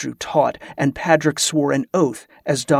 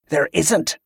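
ordinary delivery, nothing marked

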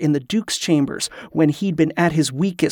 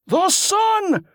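very high, exultant cry; joyful roar. A narrator's reading. Liam proclaims the miracle to the sky, roaring joyfully. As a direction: hurl your voice into the sky. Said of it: "Liam roared".

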